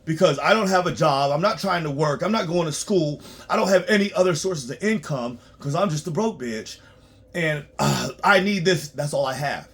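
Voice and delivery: mocking a broke client. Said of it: "they're like".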